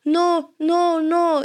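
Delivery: put-on high child's whine of refusal; pleading child. Daughter refusing to leave mom at school, pleading child voice. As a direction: imitate a little girl protesting.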